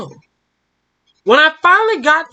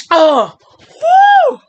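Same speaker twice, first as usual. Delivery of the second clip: excited whoop; 7 dB louder than usual. Real, not acted. Reader reacts loudly to twist.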